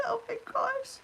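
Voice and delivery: high, dismayed squeal; surprised squeal. Real, not acted. Looking at her red nose.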